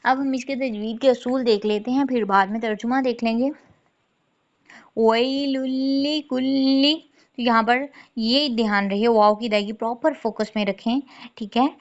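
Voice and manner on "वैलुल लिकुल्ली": slow melodic tajweed chant; Quranic recitation. Shown as a demonstration. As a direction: recite slowly with drawn vowels. Quran recitation demo in lesson. (धीमी तजवीद वाली तिलावत)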